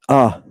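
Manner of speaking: "uh" as a brief vowel; short upsilon. Shown as a demonstration. Teacher showing students the short version, brief "uh". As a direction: keep "uh" short. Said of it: "it has a short ve-, version".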